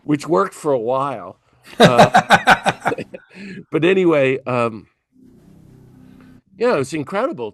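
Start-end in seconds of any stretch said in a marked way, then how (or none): 1.8-3.7 high laugh breaking through the uh; short embarrassed giggle; self-mocking laugh. Real, not acted. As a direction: laugh at yourself. Laughs about getting in shape.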